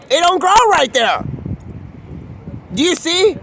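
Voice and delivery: high, loud indignation; indignant outburst. Real, not acted. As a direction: shout it out, offended. Indignant about mustache.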